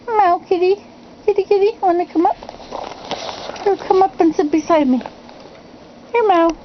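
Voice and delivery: high-pitched pet-calling voice; coaxing the cat. Real, not acted. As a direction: sweet-talk a cat into coming over. Coaxing her cat to come up.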